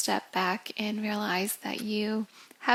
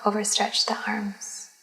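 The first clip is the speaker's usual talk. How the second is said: guided meditation; 6 dB louder than usual. Acted. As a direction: lead them gently into relaxation, phrase by phrase. Leads a yoga nidra.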